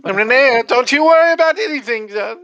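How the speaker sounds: loud, piercingly high lady's voice; overbearing hostess reassurance. Acted. Game master as the woman telling Renair not to worry, loud falsetto. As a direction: gush reassurance in a big falsetto.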